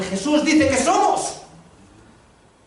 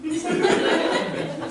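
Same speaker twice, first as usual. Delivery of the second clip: breathless unvoiced laugh; a light, airy chuckle; real amused laugh; 9 semitones higher, 4 dB quieter than usual. Real, not acted. Laughs at own joke.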